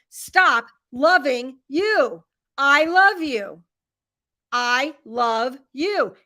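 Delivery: mother insisting to her son, slow and emphatic; retold yell. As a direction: lean into every word, slow and insistent. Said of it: "I started yelling at him".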